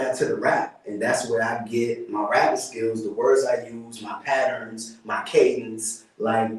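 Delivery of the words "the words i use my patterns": usual speaking voice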